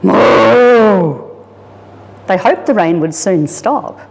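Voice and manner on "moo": a grumpy, louder cow moo; grumbling moo. Acted. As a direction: moo like a cow fed up with rain.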